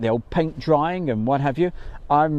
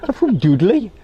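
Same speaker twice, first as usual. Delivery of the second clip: put-on playful, higher and louder Dudley accent; mock Dudley accent; 8 semitones higher, 5 dB louder than usual. Host joking with shy young man from Dudley, mock Dudley accent.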